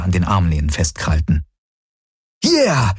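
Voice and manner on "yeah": a much higher burst of glee; sudden excited cry. Acted. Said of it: "rief Galen unvermittelt aus".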